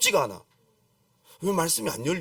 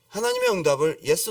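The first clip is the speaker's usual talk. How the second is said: ordinary talk, nothing marked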